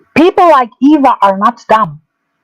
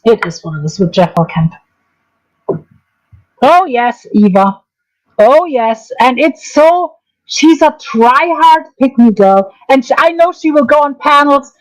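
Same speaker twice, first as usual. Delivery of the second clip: ordinary delivery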